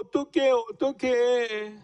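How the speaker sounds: a slow, whimpering child's fret, lightly acted; mock crying. Pastor voices Minjae crying at preschool, lightly whimpering. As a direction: drag out the worry like a small crying child. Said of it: "막 우는 거예요".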